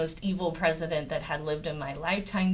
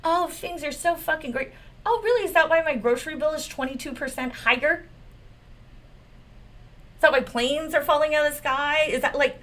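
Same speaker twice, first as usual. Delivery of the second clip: sarcastic mock rant; 7 semitones higher, faster than usual. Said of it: "He's sitting".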